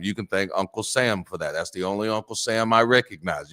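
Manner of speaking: usual speaking voice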